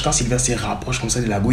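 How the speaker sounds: ordinary talk, nothing marked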